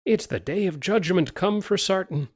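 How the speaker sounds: high, frightened exclamation; he thinks the world is ending; panicked. Acted. Sailor fleeing at the gate, high panicked cry.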